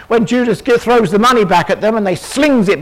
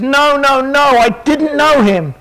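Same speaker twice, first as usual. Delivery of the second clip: higher, drawn-out protest; emphatic denial; 5 semitones higher, slower than usual. Acted. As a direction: deny it insistently, pitched up and slowed down. Peter's last, emphatic denial acted.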